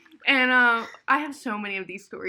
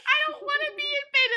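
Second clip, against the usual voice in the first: very high, rushed wail; hammed-up tearful whine; mock hysterical crying; faster than usual. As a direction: imitate bawling in a panic. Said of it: "I was hysterically crying".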